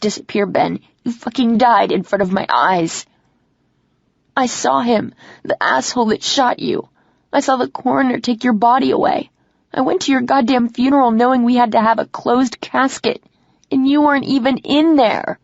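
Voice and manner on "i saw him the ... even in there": high, loud anguished yelling that keeps climbing; screaming. Acted. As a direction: scream the grief at him. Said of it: "I scream even louder".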